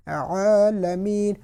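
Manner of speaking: عالمین with a light hold on the لا; slight pause on lam. Shown as a demonstration. Teacher showing the slight stay on lam. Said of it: "لام کے اوپر بالکل ہلکا سا انہوں نے اسٹے کیا ہے".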